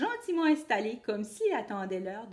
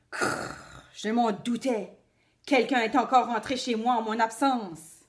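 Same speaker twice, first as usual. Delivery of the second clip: growl, then grumble like a cross wolf; a big bad wolf, annoyed; angry growling wolf; 6 dB louder than usual. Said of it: "il pousse un grognement de colère".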